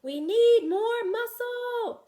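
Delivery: high, slow loud call. Acted. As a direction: stretch each word, call it out.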